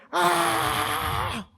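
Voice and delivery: a sudden high startled yell, half-asleep; startled scream. Retold or imitated. Husband jolted awake, screaming at his wife: a sudden startled scream.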